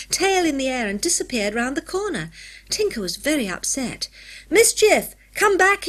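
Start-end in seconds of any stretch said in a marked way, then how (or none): 4.5-5.9 desperate high shout after a runaway; frantic boy yelling after his monkey. A narrator's reading. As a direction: call out loud and high. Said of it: "he yelled".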